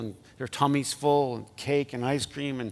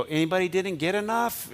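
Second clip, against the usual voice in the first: cheery, higher-pitched offer; generous aunt offering candy; 6 semitones higher than usual. Retold or imitated. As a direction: sing out the offer to the kids. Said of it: "and go".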